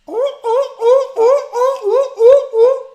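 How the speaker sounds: very high, loud seal barks, a laugh breaking in at the end; seal bark retry. Shown as a demonstration. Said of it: "That's not the best".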